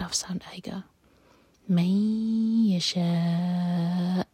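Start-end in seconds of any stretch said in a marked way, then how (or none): 1.7-4.2 یشاء stretched four counts, hamza cut off; long madd. Shown as a demonstration. Teacher models the four-count stretch very slowly. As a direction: draw out the شا, then stop sharply on the hamza. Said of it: "چار حرکہ لمبا کھینچیں گے".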